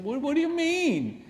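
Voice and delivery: high, squeaky, confused "what"; bewildered. Retold or imitated. Husband baffled by his wife, squeaking high. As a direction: jump way up in pitch, baffled. Bewildered retort, much higher.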